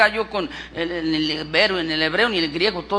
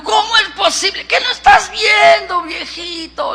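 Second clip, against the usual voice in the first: put-on high-pitched, exclaiming old wife; Sara incrédula; 12 semitones higher, 9 dB louder than usual. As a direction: scoff at your husband in a raised voice. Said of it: "se estaba riendo Sara". (esposa incrédula, voz muy aguda)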